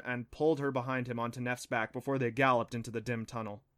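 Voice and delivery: ordinary delivery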